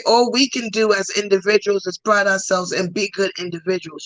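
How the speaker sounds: ordinary talk, nothing marked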